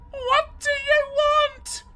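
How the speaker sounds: put-on high, loud girl's cry; a frightened girl shouting into the dark; shouted out. A narrator's reading. Helen shouting at the ghost, high and scared. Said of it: "She shouted out".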